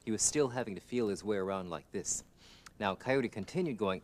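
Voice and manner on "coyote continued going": plain reading voice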